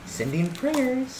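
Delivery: slow, high, soft mock-solemn sympathy; mock sincere. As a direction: drawl it like a hollow condolence. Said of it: "people are like".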